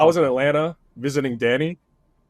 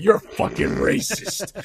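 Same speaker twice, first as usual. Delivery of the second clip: drawn-out, higher teasing accusation; mock accusation; 6 semitones higher, slower than usual. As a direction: poke fun with a stretched-out fake accusation. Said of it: "I kinda poke fun at them".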